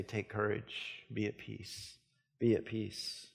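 softly spoken words of Jesus; gentle reassurance. Acted. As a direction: comfort them slowly and softly. Jesus speaks to the anxious.

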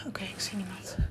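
soft, wary whisper; tense whisper. Acted. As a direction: whisper while peering into the dark. Checking outside in fear.

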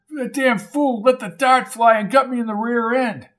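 a hint of an outraged old man; indignant old man. A narrator's reading. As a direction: complain about being hit. Old man complains of dart.

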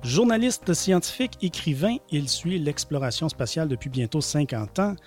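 upbeat radio show intro; public radio host. Acted. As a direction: warm announcer welcome over music. Show intro over music.

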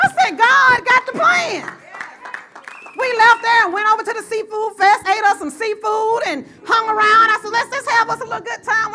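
loud, high preacher's build; full-force preaching. Acted. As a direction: preach it out to the crowd.

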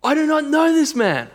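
high, hard forceful insistence; emphatic denial. Retold or imitated. Peter cursing, denying Jesus before bystanders, emphatic. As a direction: deny him with everything you've got. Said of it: "And then emphatically".

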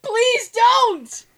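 loud, high, drawn-out begging through laughter; horrified pleading. Real, not acted. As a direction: beg them to stop, half laughing. Begs co-host to drop granny porn.

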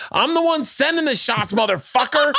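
high-pitched mimicked woman's holler; shrieky, hammed-up outburst imitating a woman; heckler's yell. Heckler stands up and yells back.

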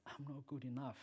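normal talking voice

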